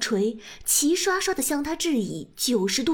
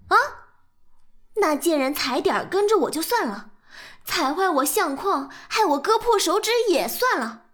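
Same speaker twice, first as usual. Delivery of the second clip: angry; 3 semitones higher, 3 dB louder than usual. Acted. Furious confrontation at door.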